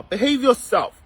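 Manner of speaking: ordinary delivery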